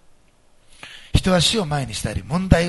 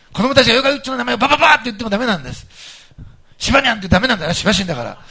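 excited joking; 8 semitones higher, 8 dB louder, faster than usual. Real, not acted. Joking about kids' cartoon names.

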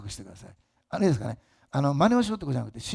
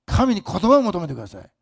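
fervent exhortation; 5 semitones higher, 9 dB louder than usual. Acted. Preaching at full force.

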